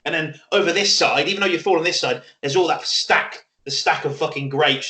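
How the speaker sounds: ordinary talk, nothing marked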